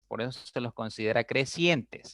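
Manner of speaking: ordinary talk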